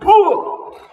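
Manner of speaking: high loud startled "Oh"; startled yelp. A narrator's reading. Husband startled by eye in beer, high yelp. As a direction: jump at the glass eye.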